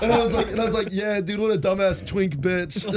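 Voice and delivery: cracking up mid-quote; laughing. Real, not acted. Quoting his balcony jokes about Tony, laughing hard.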